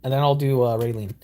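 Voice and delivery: ordinary talk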